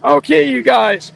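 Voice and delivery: loud, drawn-out call to the crowd. Real, not acted. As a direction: project it to the crowd. Calling out to the crowd.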